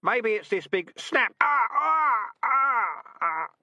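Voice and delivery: high, loud rising cry of terror; panicked wail. Acted. Recruit panicking at the trap until she faints, wailing. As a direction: break into a frightened wail before fainting.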